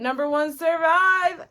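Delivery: loud, high-pitched reveal of the winner; excited announcement. Real, not acted. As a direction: belt out the top pick with real excitement. Announcing favourite song at top spot.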